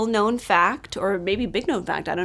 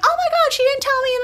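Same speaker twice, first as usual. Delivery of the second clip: imitating mom, much higher, in a shocked squeal; mom's shocked squeal; 5 dB louder, faster than usual. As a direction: play the stunned mother, high and breathless. Mom reacting, voiced in story.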